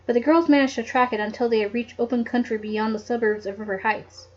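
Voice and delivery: ordinary delivery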